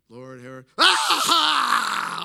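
loud high wailing scream; scream-sob. Retold or imitated. Imitating wife at the deathbed, scream-sobbing. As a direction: break into a huge mock sobbing scream. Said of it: "starts doing this scream sob thing".

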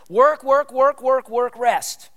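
punchy, loud drumbeat on WORK before REST; driving repetition. Shown as a demonstration. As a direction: WORK pounded loud and high on each repeat, easing on REST. Acting out the rhythm of the week.

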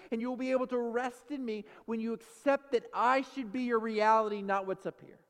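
high, loud, forceful preaching; impassioned sermon voice, driving each phrase; preaching as God. Acted. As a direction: thunder God's answer to the congregation. Preaches at length as God answering.

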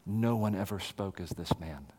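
hushed awe. Acted. Officers' awed reply, hushed.

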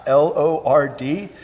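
L O R D, each capital letter named separately; spelled out letter by letter. Shown as a demonstration. Preacher spelling out the all-caps word for the congregation, letter by letter. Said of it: "where the Lord is all caps".

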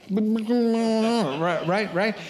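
high, laughing, doubtful right right; skeptical crowd. Retold or imitated. Townsfolk doubting the healed man, amused disbelief. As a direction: humor him, half-laughing disbelief. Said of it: "everybody's like".

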